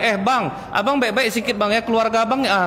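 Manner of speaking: high, indignant warning; mock indignant wife. Wife warning her husband in a quarrel, mock indignant. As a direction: put the husband in his place.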